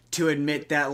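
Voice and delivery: ordinary delivery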